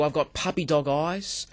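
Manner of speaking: ordinary delivery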